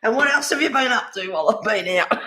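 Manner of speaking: playful mock telling-off to a cat; mock scolding the cat. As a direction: tease the naughty cat as if he can answer.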